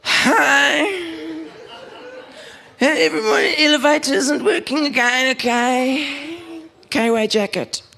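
very high-pitched mock neighbour voice; high, drawn-out whine of a group-chat complainer; Gillian. Gillian tells the neighbourhood group the elevator is broken, squeaky high caricature voice. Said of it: "read this in the voices that I believe".

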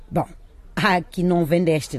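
normal talking voice

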